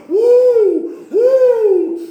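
very high, loud whoop; Sue. Retold or imitated. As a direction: imitate a frail churchgoer's long, joyful whoop. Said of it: "She'd pull the oxygen out".

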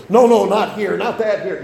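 loud, high, hurried pleading; panicked protest. Acted. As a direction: act out a sinner begging to stop. Sinner protesting as his deeds are shown.

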